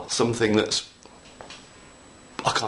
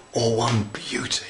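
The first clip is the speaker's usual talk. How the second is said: whisper. Real, not acted. Whispered aside while crafting.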